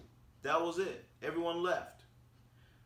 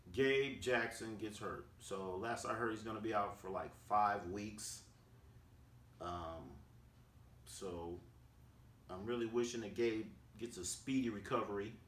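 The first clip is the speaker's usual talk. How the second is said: subdued regret; 4 semitones lower, 5 dB quieter than usual. Real, not acted. Reports a player's injury with regret.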